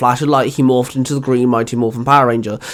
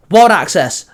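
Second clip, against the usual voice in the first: loud, higher morph call; 5 semitones higher, 4 dB louder than usual. Acted. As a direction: shout the command. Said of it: "Leo called out".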